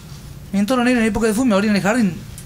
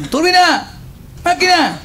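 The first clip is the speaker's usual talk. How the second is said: shouted nicknames; 7 semitones higher, much slower than usual. Retold or imitated.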